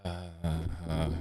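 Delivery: raspy zombie groan; zombie noises. Pretend. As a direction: croak it out like a zombie. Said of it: "this book makes me make zombie noises".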